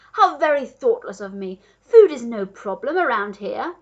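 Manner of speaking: loud, emphatic exclamation; a duke loudly brushing off a worry; crying out. A narrator's reading. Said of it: "cried the Duke".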